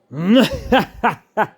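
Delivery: put-on big high old man's laugh; loud booming laughter of agreement; hearty laugh. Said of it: "exclaimed the old Spaniard".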